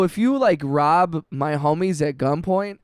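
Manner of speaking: ordinary talk, nothing marked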